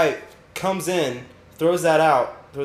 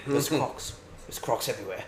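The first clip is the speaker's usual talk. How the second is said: mock Aussie accent; 8 dB quieter than usual. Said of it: "Steve Irwin on acid".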